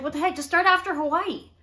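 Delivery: high, loud imitated protest; mock exasperation. Imitating friend urging drinks in Hawaii, loud exasperation. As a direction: protest it loudly, hammed up. Retold argument over drinking.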